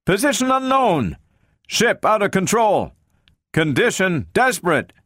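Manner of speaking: high, strained calling out; desperate shouting. A narrator's reading. Stranded crewmen answer the unseen voice, desperate high shouts. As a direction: call out each report high, as if to someone distant. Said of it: "They shouted in turns and together".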